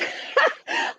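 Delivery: high, airy giggle with no voice; breathless laugh. Real, not acted. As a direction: laugh breaks through again. Laughing at the message.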